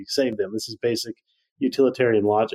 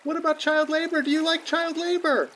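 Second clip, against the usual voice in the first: put-on high eager child voice. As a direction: bargain like a desperate kid. Drowning child bargains.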